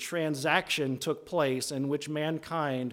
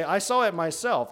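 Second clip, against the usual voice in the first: "I saw it myself" hammered home, louder; emphatic insistence; 3 semitones higher, 5 dB louder than usual. Shown as a demonstration. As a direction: stress "myself" to insist on first-hand witness. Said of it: "I'm emphasizing, I'm really kind of hammering home".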